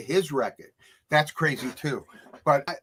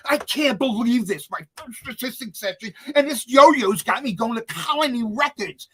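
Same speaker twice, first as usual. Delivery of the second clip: higher, worked-up grumbling to yourself; indignant muttering; 8 semitones higher, 4 dB louder than usual. Retold or imitated. Teen assistant running an errand for the star, worked up. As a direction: fume your way down the street. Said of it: "the whole way I'm going".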